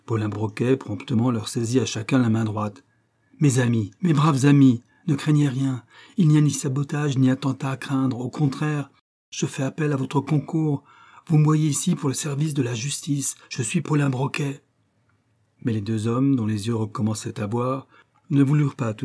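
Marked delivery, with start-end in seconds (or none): 4.0-4.8 mes braves amis, raised and much louder; louder repeat. Acted. 5.1-14.6 a lightly raised, firm yet soothing detective's voice; calm the frightened men with authority; reassuring detective. Acted. Broquet calms the two men.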